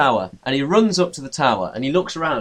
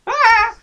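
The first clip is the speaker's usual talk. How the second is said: very high, loud comic shriek; mock terrified scream; 5 dB louder than usual. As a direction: scream in hammed-up fright. Man screams at the gorilla, acted.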